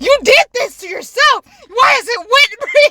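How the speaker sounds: high, loud mock accusation; accusing mom. Mom blaming Brie for the wet bed, accusing. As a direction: imitate mom's exasperated high-pitched blame.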